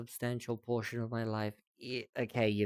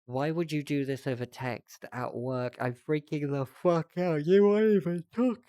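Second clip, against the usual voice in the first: frantic, rising panic; panicked; 3 semitones higher, 6 dB louder than usual. Retold or imitated. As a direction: read it like you're freaking out. Reading OP's panicked texts aloud.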